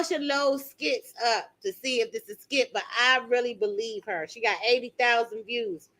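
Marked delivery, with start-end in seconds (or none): none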